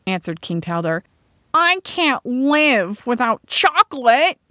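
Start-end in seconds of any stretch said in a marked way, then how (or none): 1.5-4.4 high, loud, drawn-out whine; wailing cry. A narrator's reading. Groster learns cacao may not grow, crying out. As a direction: wail it slowly like a spoiled complaint. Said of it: "cried Groster".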